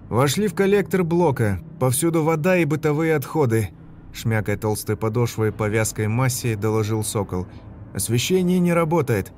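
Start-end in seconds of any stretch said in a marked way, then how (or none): 0.1-3.7 soldier's radio report. Acted. 7.9-9.3 soldier's radio report. Acted.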